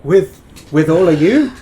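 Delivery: rising panic in the voice; alarmed. Acted. Sheriff alarmed at staying with the party.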